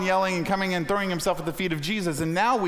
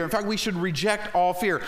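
ordinary delivery

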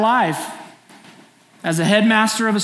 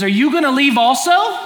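pleading question; 7 semitones higher, 5 dB louder than usual. Retold or imitated. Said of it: "turns to the twelve and says".

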